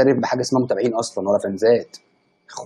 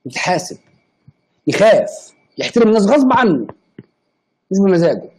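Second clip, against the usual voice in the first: higher-pitched, emphatic rant; heated scolding; 5 semitones higher, 4 dB louder than usual. Real, not acted. Streamer telling off those who insult everyone, heated scolding. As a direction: lay down the law, voice raised.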